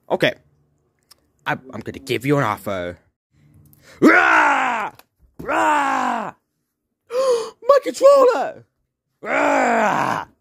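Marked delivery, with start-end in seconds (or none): 4.0-4.9 a loud, high monster roar; dinosaur roar. Acted. Dinosaur attacks the players. 5.4-6.3 dinosaur roar. Acted. Dinosaur attacks the players. 7.1-8.6 gasp, then cry out much higher and slowly; shocked yelp at the stolen controller. Acted. Dinosaur grabs the controller. 9.2-10.3 dinosaur roar. Acted. Dinosaur attacks the players.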